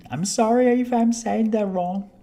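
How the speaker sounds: loud, high apologetic call; high-pitched apology. Real, not acted. Streamer to viewer over a fumbled name, high apology. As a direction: apologize with pitch pushed well up.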